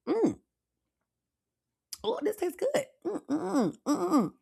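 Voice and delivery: high, soft delighted humming; savoring a sip. Real, not acted. Tasting the drink on air.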